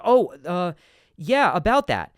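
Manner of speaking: loud, high flustered reply; thrown off. Acted. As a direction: blurt it like a boss caught off guard. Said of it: "he'll be thrown off".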